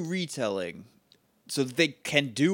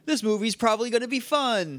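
mock hopeful; 7 semitones higher than usual. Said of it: "What a joke".

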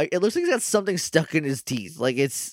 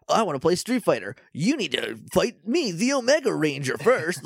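higher, theatrical game announcer challenge; arcade fighting game voiceover; 3 semitones higher than usual. Acted. Podcaster mimicking a villain-style character to co-host, arcade voiceover. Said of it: "arcade cabinet fighting game voiceover".

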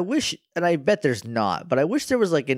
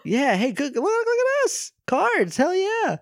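very high, put-on gleeful squeal; silly high-pitched voice, hyping the idea; mock excited. Game developers congratulating each other over cards, mock excited. Acting out clueless developers pleased.

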